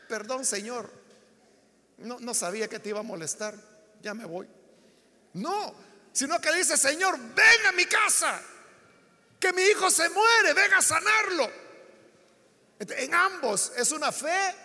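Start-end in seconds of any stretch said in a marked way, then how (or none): 0.1-4.5 quieter, lower, retreating apology; disculpa sumisa. Acted. Imagina una disculpa tímida. 6.8-11.5 raised, higher-pitched plea; súplica urgente. Acted. Padre suplica por su hijo.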